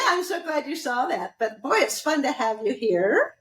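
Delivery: ordinary talk, nothing marked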